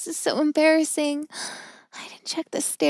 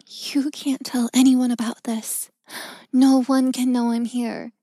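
ordinary delivery

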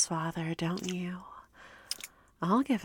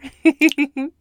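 wicked laugh; 6 semitones higher, 13 dB louder than usual. Acted. Mocking the priest's piety.

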